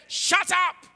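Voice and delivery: loud, high imitated order to be silent; sharp silencing shout. Bystanders try to silence the blind beggar, shouting.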